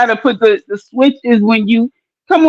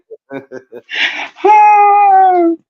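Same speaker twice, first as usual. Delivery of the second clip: laughing sigh; 9 semitones higher than usual. Real, not acted. Reacting to joke.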